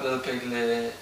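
ordinary delivery, nothing marked

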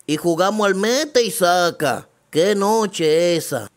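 loud, boastful storyteller man; brash man. Acted. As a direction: brag it out to her face, loudly. Man telling teacher about night.

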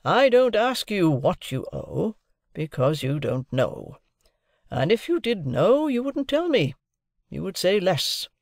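high, slow, emphatic lecture; overbearing lawyer. A narrator's reading. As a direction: overrule them point by point. Lawyer figure lectures his ward.

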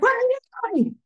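put-on high, startled child voice asking; a kid catching mom mid-ritual, baffled; kids puzzled. Kids catch mom swaying mid-call, high baffled voice. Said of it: "they'd be like".

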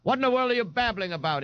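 high, quick indignant outburst; thundering. A narrator's reading. Ignatius interrupting his mother's call, mildly thundering. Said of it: "Ignatius thundered in the hall".

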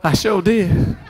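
ordinary delivery

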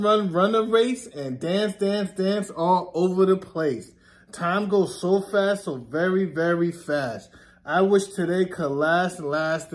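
ordinary delivery

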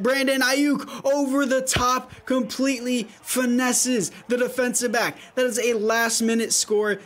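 lively sports commentary; play-by-play. Acted. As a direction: call the game live. Live play-by-play of his game.

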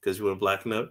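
lightly hammed-up stern father; low fatherly voice. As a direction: talk down to the boy like a dad. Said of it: "He's just like".

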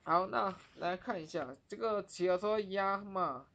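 usual speaking voice

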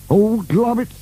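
high-pitched cry of dismay; alarmed thought. A narrator's reading. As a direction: exclaim in alarm as you start slipping. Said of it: "he thought".

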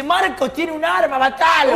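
high, stretched panicky warning; retold alarm. Robber warning his partner that Mario is armed, high alarmed cry. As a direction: raise the pitch, mock alarm.

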